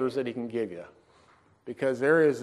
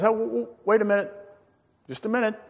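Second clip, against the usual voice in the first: high, quick stammered interruption; acted-out hurried "hold on"; calling someone back; 9 semitones higher, faster than usual. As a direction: stop someone at the door. Calling him back at door.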